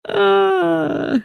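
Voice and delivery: high-pitched laughing fit; high helpless laugh. Real, not acted.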